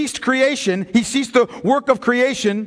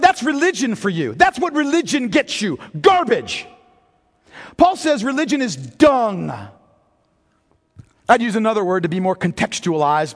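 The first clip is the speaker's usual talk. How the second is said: ordinary talk, nothing marked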